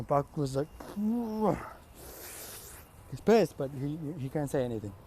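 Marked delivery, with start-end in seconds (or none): 0.7-3.0 long blown-out sigh; exasperated sigh. Acted. Pissed character gives up on answering, exasperated sigh. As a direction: huff out a long breath.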